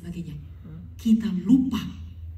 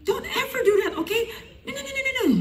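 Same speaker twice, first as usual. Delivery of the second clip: very high, hammed-up imitation; acted scolding exchange. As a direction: squeal it out in a put-on voice. Acted exchange after tearful apology.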